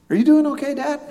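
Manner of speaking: high-pitched imitation of a concerned child; son checking on Dad. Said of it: "turns to me and he goes".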